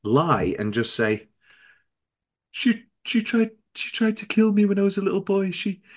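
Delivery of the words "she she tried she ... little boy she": high, stammering fake victim; hammed-up pleading, halting and high; mock desperate lie. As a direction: stumble through a made-up sob story, pitched high. Acting out a desperate invented lie.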